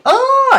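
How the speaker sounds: sudden high, loud "Oh!" scream; demonstrated scream. Shown as a demonstration. Game master shows player how to react, screaming out loud. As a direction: let out a loud, high startled cry. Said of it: "and scream out loud".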